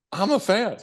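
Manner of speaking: gushing fan. Retold or imitated. Fan telling the doctor he admires him, giddy and gushing.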